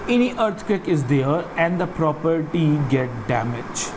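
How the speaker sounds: ordinary delivery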